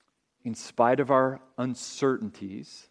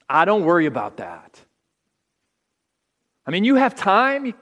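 higher and louder, breezily brushing it off; a loud, high tempter coaxing them to relax; dismissive tempter voice; 6 semitones higher, 7 dB louder than usual. Acted. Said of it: "another pole saying some stuff like this".

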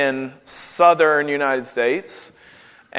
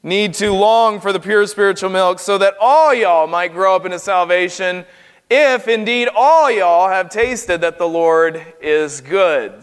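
Southern paraphrase. Retold or imitated. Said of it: "Peter here is addressing the church, and he's saying".

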